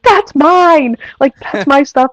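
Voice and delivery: high, loud cry easing slightly; retold excited yell. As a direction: re-enact yelling out in excitement. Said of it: "I just yelled 'cause I was so excited".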